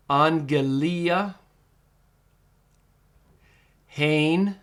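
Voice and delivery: each Greek word said alone, clear and deliberate, with long pauses between; teacher reading Greek aloud one word at a time; careful Greek pronunciation. Shown as a demonstration. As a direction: model Koine Greek word by word so students can repeat. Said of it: "It is pronounced".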